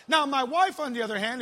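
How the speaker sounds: ordinary delivery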